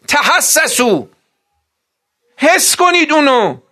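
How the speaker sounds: high, loud, impassioned sermon; full-force preaching. Real, not acted. Preacher rousing the mourning gathering, full-force preaching. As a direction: preach it out, pressing hard on every line.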